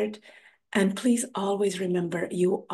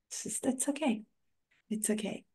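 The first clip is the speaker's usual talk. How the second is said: breathy reassuring whisper, almost no voice; soft whisper; 5 semitones higher, 9 dB quieter than usual. Real, not acted. Host reassuring the listener, whispered softly. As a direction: whisper it gently like a comfort. Soothing guest about embracing imperfection.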